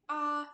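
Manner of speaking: demo ah. Shown as a demonstration. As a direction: model the ah for the patient. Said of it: "have you do the".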